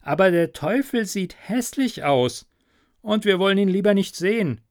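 mock warning. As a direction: hurry the warning like a sly trickster. Klaus warns, quick and higher.

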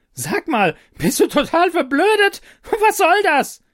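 very high, loud, beside herself with rage; furious scream. Acted. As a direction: scream at the stupid computer. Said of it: "schrie sie außer sich vor Wut".

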